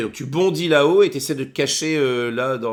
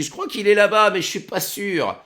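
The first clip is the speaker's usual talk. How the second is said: goblin voice; 8 semitones higher than usual. Acted. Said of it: "il y en a un quand même qui dit".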